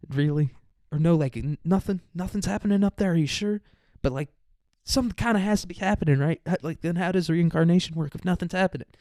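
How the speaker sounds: high, disbelieving questions; incredulous. Real, not acted. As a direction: push back in disbelief.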